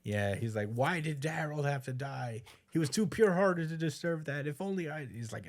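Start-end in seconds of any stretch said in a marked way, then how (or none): 0.7-5.1 higher-pitched, mildly anguished plea; a touch of grieving protest; mock lament. Grieving friend asks why Harold had to die, mild lament.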